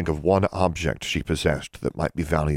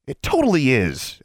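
high, louder angry protest; angry retort; 9 semitones higher, 4 dB louder than usual. A narrator's reading. Girl arguing with the hag, angry. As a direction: insist hotly. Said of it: "Alexandra said angrily".

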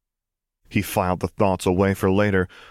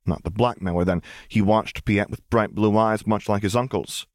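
plain reading voice

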